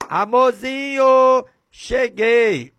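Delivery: high, sweet, drawn-out loving call; sweet husband greeting. Retold or imitated. Preacher mimics a husband sweetly calling his wife. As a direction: coo it like a doting husband.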